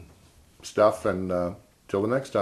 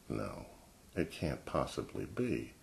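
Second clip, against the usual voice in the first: inner thought; 5 semitones lower, 14 dB quieter, slower than usual. Retold or imitated. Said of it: "I'm thinking".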